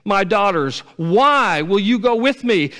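high, loud, urgent plea; Naomi pleading. Acted. Naomi urging her daughters-in-law to go home, loud high plea. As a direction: push them away out of love. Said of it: "she's saying".

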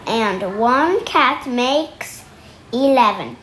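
ordinary delivery

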